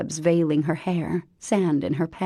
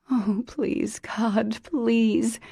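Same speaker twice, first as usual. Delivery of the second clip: pleading; 5 semitones higher, much slower than usual. Acted. Pleading prayer.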